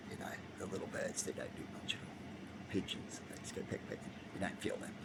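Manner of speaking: hushed whisper aside. Real, not acted. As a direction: drop to a breathy whisper. Whispered aside about little birds.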